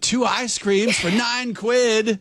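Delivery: high, slow imitation of little British girls; mock British accent. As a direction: mimic a child complaining in a British accent. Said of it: "Is, is that your accent?".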